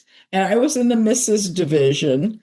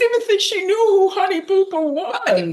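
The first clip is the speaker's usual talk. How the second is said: much higher laughing squeak; silly high voice; 12 semitones higher than usual. Pretend. Surprised her friend knew the show, high laughing squeak. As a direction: giggle through it in a high mock-shocked voice. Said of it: "Now we're just getting overly silly".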